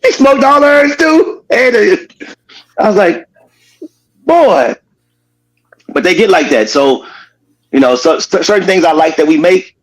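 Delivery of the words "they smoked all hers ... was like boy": amused disbelief. Real, not acted. Laughing retelling of friend's binge.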